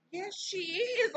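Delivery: high, drawn-out exasperated "yes"; exasperated reply. Retold or imitated. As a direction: stretch it out high in mock disbelief. Group's exasperated reply to the mother.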